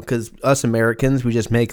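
normal talking voice

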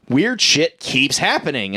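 ordinary talk

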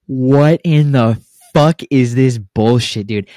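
slow, drawn-out mock indignation; retold outrage. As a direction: stretch the words out in disbelief. Retelling outrage at lunch table.

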